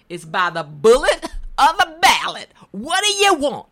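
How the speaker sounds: loud, high, fiery outburst; angry Malcolm X quote. Retold or imitated. Retelling Malcolm X to the host, angry shout. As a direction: hurl it like a rally speech. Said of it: "You know, that anger".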